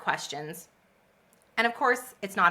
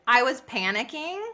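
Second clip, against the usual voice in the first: ordinary talk, nothing marked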